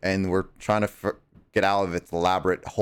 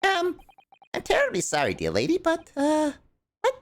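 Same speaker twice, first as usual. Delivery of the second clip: put-on high old judge voice. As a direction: welcome her like a doddering judge. Voicing the old judge again.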